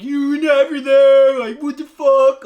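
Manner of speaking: high, very loud mock tantrum; temper tantrum. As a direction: yell like someone throwing a fit. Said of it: "throwing a temper tantrum".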